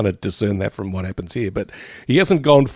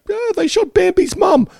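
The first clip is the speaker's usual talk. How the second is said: high, squeaky mock-horrified gasp; shocked viewer. Co-host mocking a shocked viewer, high squeaky horror. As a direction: squeal it like a kid upset over Bambi. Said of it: "you wouldn't be like".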